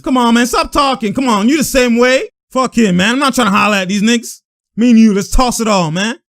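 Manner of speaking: imitated trash talk. Imitating players arguing after the game, heated trash talk. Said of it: "you see Smart complaining".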